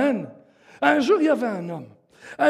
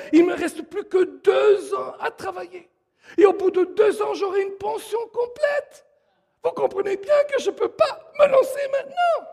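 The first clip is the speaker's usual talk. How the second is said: acted excuse-making; 8 semitones higher than usual. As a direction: pitch climbing higher and higher. Acts a man's excuses to pastor. (excuse mimée, voix de plus en plus aiguë)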